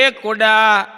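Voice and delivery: loud call across a crowd; calling out loudly. Retold or imitated. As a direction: shout the name to make heads turn. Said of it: "આમ જોરથી અવાજ કર્યો". (ભીડમાં જોરથી બૂમ પાડવી)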